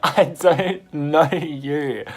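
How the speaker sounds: loud, slow, drawn-out retort; exasperated retort. Retold or imitated. Teller rebuffs the pushy stranger, loud and exasperated. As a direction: re-enact it loudly, stretching every word.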